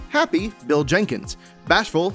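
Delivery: ordinary delivery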